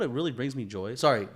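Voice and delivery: usual speaking voice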